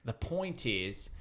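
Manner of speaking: ordinary delivery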